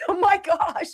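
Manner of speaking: high mock-despairing cry; exasperated groan. Homeowner hearing the alarm return, mock despair. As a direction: whimper it in hammed-up exasperation, high.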